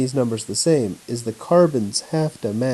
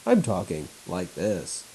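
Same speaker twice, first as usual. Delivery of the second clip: weird announcer voice; 5 semitones lower, 5 dB quieter than usual. Real, not acted. Teacher demonstrating the voice he's been using on his laptop, low and flat. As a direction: lower and softer, a flat monotone announcer read. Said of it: "some sort of weird announcer voice".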